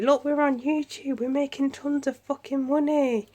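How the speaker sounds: high, loud mocking boast; mock uppity. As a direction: imitate smug YouTubers showing off. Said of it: "All uppity".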